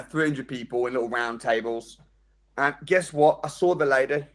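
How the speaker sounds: usual speaking voice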